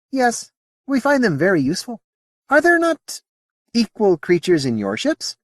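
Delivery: high, lilting alien voice; a curious alien, politely puzzled; alien Modi voice. Acted. Alien answering Sally's question in a high voice.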